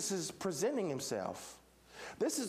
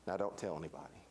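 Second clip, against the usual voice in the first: very low, soft aside; hushed secretive instruction; 7 dB quieter, faster than usual. Acted. Jesus cautioning Peter after his confession, hushed. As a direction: drop down as if keeping it secret.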